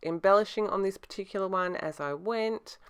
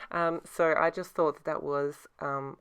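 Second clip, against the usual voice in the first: normal talking voice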